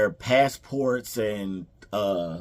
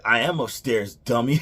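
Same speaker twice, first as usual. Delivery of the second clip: sassy loud comeback; 3 semitones higher, 8 dB louder than usual. Retold or imitated. Kevin sassing his mom from upstairs, loud and cheeky. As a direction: snap back like a cheeky kid. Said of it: "He's like".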